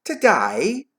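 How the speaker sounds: put-on high, wondering boy with a British accent; changed accent. A narrator's reading. Boy answering Scrooge, in changed British accent. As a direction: turn to a British boy's accent, rising. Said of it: "Notice my accent's changed".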